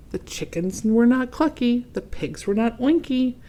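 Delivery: ordinary delivery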